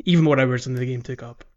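ordinary talk

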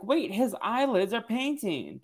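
high, drawn-out mock astonishment; acted surprised discovery. Retelling Eva catching the painted eyelids, mock surprise. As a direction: raise the pitch and stretch out the realization. Acts out Eva's startled discovery.

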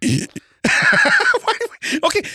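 laughing. Real, not acted. Bursts out laughing at partner.

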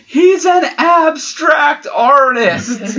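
high, slow shout through laughter; laughing shout. Retold or imitated. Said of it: "And Byron said".

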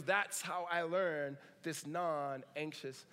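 said very slowly and deliberately; slow calm recollection; slow, measured quote. Retold or imitated. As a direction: let each word settle, unhurried. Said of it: "he goes".

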